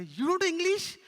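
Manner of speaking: high, loud mock question; incredulous challenge. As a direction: challenge a foreigner in loud disbelief. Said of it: "go to Arabic people and say".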